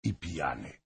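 usual speaking voice

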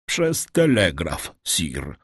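slow, hesitant reply, dropping away; stammered. A narrator's reading. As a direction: falter out the answer, sinking on the last word. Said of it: "wyjąkał".

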